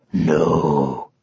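very low lion, reassuring; lion's voice. Acted. Lion reassures the anxious unicorn, in a deep voice.

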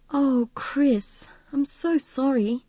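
high, tender condolence; warm sympathy. A narrator's reading. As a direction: feel deeply for a friend. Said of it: "Midge's voice was warm with sympathy".

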